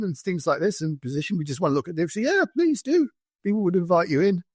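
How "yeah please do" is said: high, eager yes from a friendly local; mock-cheerful villager saying yes. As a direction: chirp it up like a welcoming host. Villager cheerfully invites the soldiers in.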